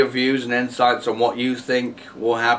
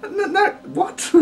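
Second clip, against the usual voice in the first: very high, slow, laughing "no, no, what?"; mock disbelief; slower than usual. Reacting to a silly claim, laughing high disbelief. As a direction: squeak out stunned disbelief through a laugh. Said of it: "and it's like".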